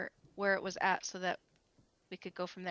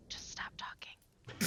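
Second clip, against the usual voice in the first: slow, hushed aside; whisper; 9 dB quieter, slower than usual. Acted. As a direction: whisper a warning to a companion. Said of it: "just gonna whisper over to".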